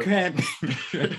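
slow, drawn-out and laughing; slow drawl. Retold or imitated. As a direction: stretch every word like a slow drunk. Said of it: "you sound like you're slow".

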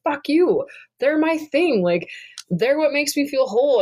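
high, heated snap back at her husband; snapping back at her husband over the horses, indignant and high; mock angry outburst. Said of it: "I'd be like".

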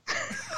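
a quick high burst of laughter; short laugh. Real, not acted. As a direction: laugh it off in disbelief. Laughs at the hateful quote.

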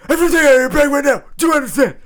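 barking drill sergeant, very loud and high; drill sergeant shout. Retold or imitated. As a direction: yell orders like a drill sergeant. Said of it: "The drill sergeants will be like".

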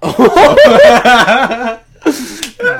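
very high, loud, long laugh; real shriek of laughter. Real, not acted. Host floored by co-host's crude comeback, shrieking with laughter. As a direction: explode into helpless high laughter.

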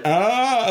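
high-pitched "ah" of terror; gape-mouthed cry, acted out; mock terrified cry. Imitating a man backed against the wall, mock terror. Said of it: "just, like, in terror".

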